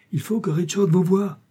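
high, urgent cry; exclamation pressée; eager exclamation. Acted. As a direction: burst out with the idea. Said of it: "s'écria alors la droite habilleuse".

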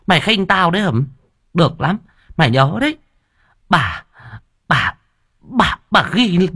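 high-pitched scolding stepmother; furious stepmother. A narrator's reading. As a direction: scold the girl in a high, indignant voice. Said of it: "thì tức tối".

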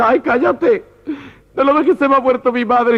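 high-pitched mock grief; hammed-up mourner's protest; tearful scolding. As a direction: hush a friend in a high, wounded whine. Mourner scolds friend through grief.

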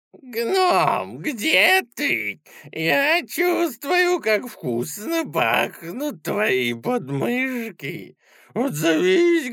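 high, creaky, slow monster voice; high creaky troll voice. Acted. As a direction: croon it like a hungry troll luring prey. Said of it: "высокий и скрипучий".

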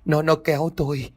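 high, whimpering and stammering; on the verge of tears, stuttering in fright. Acted. Old man whimpers to his wife about the ghost, near tears. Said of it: "mếu máo nói".

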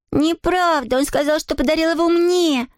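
put-on high, protesting little girl; crying out. A narrator's reading. Adele insists the train is hers, crying out high. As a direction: object in a high child's cry. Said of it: "закричала Адель".

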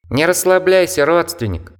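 high squeaky little creature; squeaky bat voice. Acted. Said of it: "пропищал знакомый голос".

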